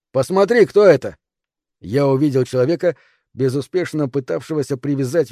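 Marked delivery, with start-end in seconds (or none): 0.1-1.2 higher, louder urgent call; shouting. Acted. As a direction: yell to be heard over the wind. Ben keeps shouting in storm. (громкий крик)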